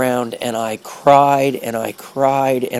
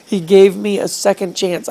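high, a bit louder, overcome; choked-up cry of relief; tearful joyful cry; 6 semitones higher, 3 dB louder than usual. Retold or imitated. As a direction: overcome with emotion. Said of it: "I just began to yell".

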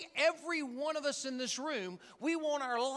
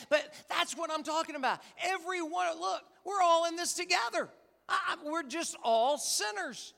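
higher, louder, driving it home to the room; rally the whole church at full voice; preaching shout; 6 semitones higher, 3 dB louder than usual. Real, not acted.

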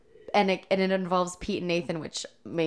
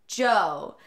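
louder repeat with Australian vowel; imitated Australian accent; 3 semitones higher, 6 dB louder than usual. Pete repeating dog's name to stranger, imitated Australian accent. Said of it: "in his Australian accent".

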